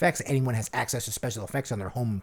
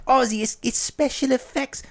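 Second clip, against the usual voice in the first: Sharon impression; 10 semitones higher than usual. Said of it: "play all three parts".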